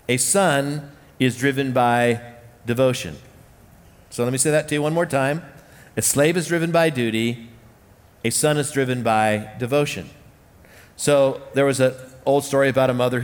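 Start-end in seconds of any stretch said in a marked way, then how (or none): none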